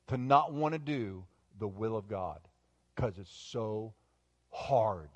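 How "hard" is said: usual speaking voice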